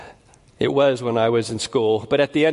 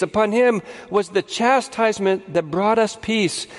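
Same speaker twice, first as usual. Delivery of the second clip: fervent preaching; 8 semitones higher than usual. Acted. Preaching at full force.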